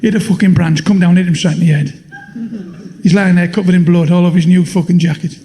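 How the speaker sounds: usual speaking voice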